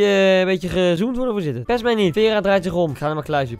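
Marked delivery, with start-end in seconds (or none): none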